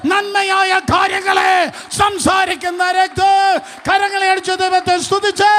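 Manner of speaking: high, loud shouted climax; full-force preaching. Acted. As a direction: build to a roaring proclamation.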